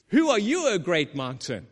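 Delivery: bold angel challenge. Retold or imitated. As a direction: call it down at the mountain. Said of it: "And the angel says".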